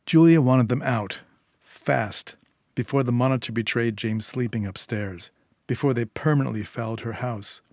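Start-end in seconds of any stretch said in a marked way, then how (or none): none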